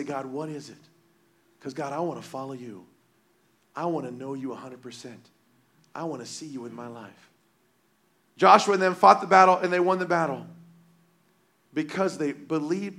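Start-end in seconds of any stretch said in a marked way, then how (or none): 0.0-7.3 softer, lower, humble plea to God; quiet sincere prayer. Retold or imitated. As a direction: pray it softly, like alone in the closet.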